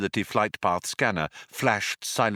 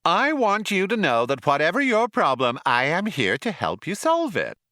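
loud, higher, brash ad-read cheer; brash cheery salesman; 7 semitones higher, 4 dB louder than usual. A narrator's reading. Ship computer pitching help to Zaphod, brash and cheery. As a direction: sell it like detergent. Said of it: "brash and cheery as if it were selling detergent".

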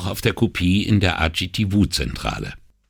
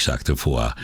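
plain reading voice

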